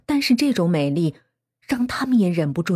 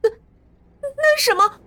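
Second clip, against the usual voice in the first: very high, stammered scream of alarm; girl's high-pitched startled cry; terrified shriek; 5 dB louder than usual. A narrator's reading. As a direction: shriek it in fright, stuttering at the start. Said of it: "韩小芳尖叫起来".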